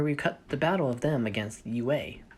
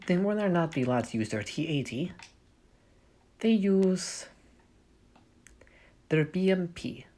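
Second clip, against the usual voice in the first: light, bad Russian accent. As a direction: narrate in a shaky Russian accent. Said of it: "I'll stop doing my Russian accent".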